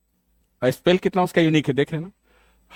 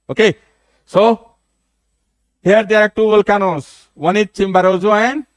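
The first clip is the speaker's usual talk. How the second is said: higher, louder and slow; loud teacher call; 7 semitones higher, 4 dB louder, much slower than usual. Real, not acted. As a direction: call it out to the whole class, slowly. Teacher announces volcanoes, raised voice. (कक्षा को ज़ोर से, धीरे-धीरे बताते हुए)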